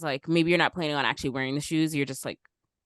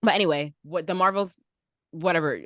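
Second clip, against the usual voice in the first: ordinary delivery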